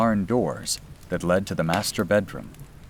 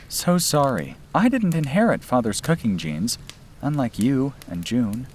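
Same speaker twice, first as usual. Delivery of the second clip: put-on higher, lightly teasing young woman; young woman's voice; 7 semitones higher than usual. Sister apologizes to brother for her cooking, higher teasing voice. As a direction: voice her apology with a playful edge.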